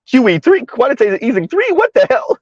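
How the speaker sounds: high-pitched, incredulous reenactment; hammed-up bewilderment; mock disbelief. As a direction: act out baffled alarm, pitch jumping up. Acts out past disbelief at QE.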